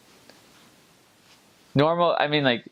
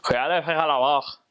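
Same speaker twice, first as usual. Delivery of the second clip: fast, slurred Lac-Saint-Jean joual, higher in pitch; Lac-Saint-Jean accent; 4 semitones higher, faster than usual. Shown as a demonstration. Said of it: "Lac-Saint-Jean would say it more like".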